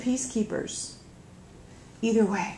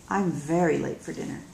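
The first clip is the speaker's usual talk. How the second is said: plain reading voice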